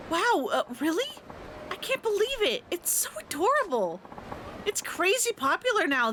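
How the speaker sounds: put-on a thrilled girlfriend gushing over her gift.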